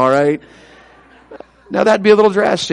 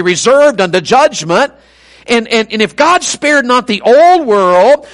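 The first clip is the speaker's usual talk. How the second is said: driving, raised-voice scripture recital; preaching; 5 semitones higher, 4 dB louder than usual. Real, not acted. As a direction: proclaim judgment to the congregation, stretching key words. Preaches scripture at full force.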